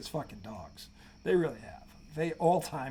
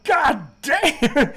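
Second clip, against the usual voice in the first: higher and louder, stunned by the dunk; amazed exclamation; 10 semitones higher, 11 dB louder than usual. Retold or imitated. Reacting to a famous dunk, in loud awe. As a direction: blurt it out in awe. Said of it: "You're like".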